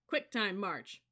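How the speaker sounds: loud drill order; shouted command. A narrator's reading. Sergeant ordering the platoon to march, loud shout. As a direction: call out the march. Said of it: "then shouted".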